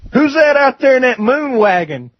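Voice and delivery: hammed-up holler from a doorway; calling out. Uncle yells down at the visitors outside his trailer, calling out.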